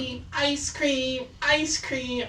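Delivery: high sing-song ice cream truck call; mock truck call. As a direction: chant it like the truck's tinny loudspeaker.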